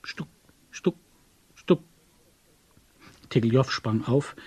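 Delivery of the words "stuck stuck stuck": short, spaced knock syllables; knocking imitation. A narrator's reading. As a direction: imitate three separate raps. Narrator imitates the secret knocking.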